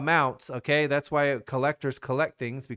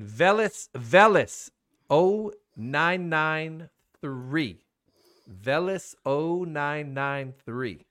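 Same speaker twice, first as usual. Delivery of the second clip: spelling it out slowly; 4 dB louder, much slower than usual. Real, not acted. Reading the username out clearly.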